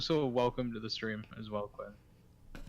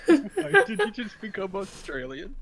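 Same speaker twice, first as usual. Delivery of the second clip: laughing disbelief; 11 dB louder than usual. Real, not acted. Laughing at friend's accent.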